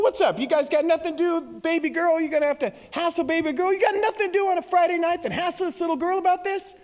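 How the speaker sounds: high, fast, hammed-up protest; rattled off high in mock outrage; indignant tirade. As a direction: berate the cop like an outraged protective dad. Acted angry dad berating detective.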